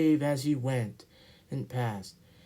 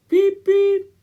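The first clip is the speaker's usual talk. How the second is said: 1 engine whistle; 14 dB louder than usual. Acted. Edward whistles at station.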